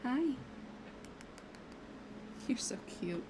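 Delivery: soft unvoiced coo to a cat; cooing whisper. Real, not acted. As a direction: whisper it fondly to the pet. Talking to the cat.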